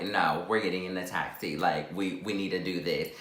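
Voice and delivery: low, hushed firm reply; low insistence. Retold or imitated. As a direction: insist quietly, pitched well down. Friend overrules the taxi question.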